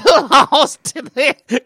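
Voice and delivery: laughing through the words. Real, not acted. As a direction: crack up mid-sentence. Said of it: "laughing fit".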